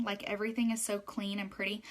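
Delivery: ordinary talk, nothing marked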